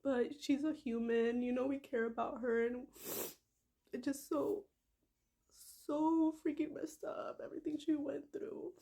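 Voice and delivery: holding back real tears; still emotional. Real, not acted. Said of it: "I get all emotional".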